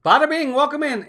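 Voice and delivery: high, loud hello to a viewer; excited greeting. Real, not acted. Streamer greeting viewer Bada bing, bright high welcome. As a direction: call out a cheerful welcome, pitched high.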